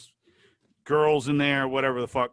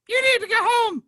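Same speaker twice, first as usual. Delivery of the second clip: very high, loud squeaky scolding; cartoon-mouse falsetto, officious; Mickey Mouse; 7 dB louder than usual. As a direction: squeak out a stern order in falsetto. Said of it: "He sounded like Mickey Mouse".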